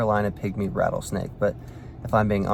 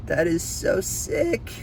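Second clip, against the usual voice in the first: a slow, higher, stretched-out awe; awed excitement; 7 semitones higher, much slower than usual. Real, not acted. Filming a pygmy rattlesnake he's been hunting, slow high awe. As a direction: savor every word, stunned. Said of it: "I'm kinda shaking".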